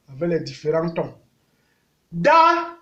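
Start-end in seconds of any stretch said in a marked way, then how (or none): 2.1-2.8 Da on a high, level, loud tone. Shown as a demonstration. Teacher reading syllables, Da on high tone. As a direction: hold Da high and even. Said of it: "ton haut".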